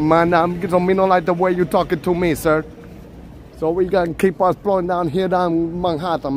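mock Jamaican English, slightly lifted; trash-talk in a hammed-up Jamaican accent; fake Jamaican accent. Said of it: "My fake Jamaican".

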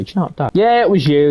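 ordinary talk

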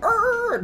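very high screeching tyre noise; imitated skid. Driver telling of a sliding truck, high skid noise. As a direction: mimic tyres sliding across the road. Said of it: "drifting, not really drifting".

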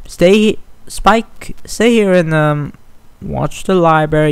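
urgent command. Acted. Twilight urging Spike to stay and guard the library, urgent. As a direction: snap the instructions out fast.

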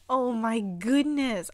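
usual speaking voice

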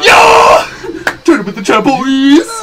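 high, loud celebratory yell; victory shout. Real, not acted. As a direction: cheer at the top of your lungs. Celebrating a win, music.

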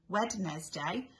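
Wednesday slow as Wed-nes-day; Wednesday with every written letter spoken; spelling pronunciation. Shown as a demonstration. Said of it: "a bit like Wednesday".

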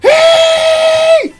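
sudden high, loud shout. Real, not acted. Gamer catching a rival off guard, high shout. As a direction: yell it out in triumph.

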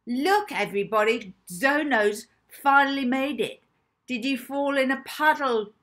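loud, high bullying taunt; coarse shout. A narrator's reading. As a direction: shout it across the room to humiliate. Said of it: "shouted coarsely".

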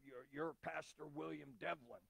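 low, soft imitation of an African pastor. Pastor greeting a visiting preacher, African voice. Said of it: "in his African voice".

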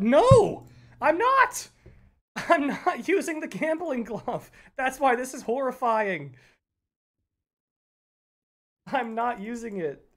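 high, loud, slow insistence; real loud flustered denial. Real, not acted. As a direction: deny it with laughing outrage. Denying using the glove.

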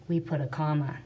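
ordinary talk, nothing marked

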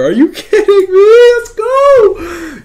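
high-pitched, loud celebration; elated yell. Real, not acted. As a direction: whoop it out in giddy disbelief. Celebrating the rare pull.

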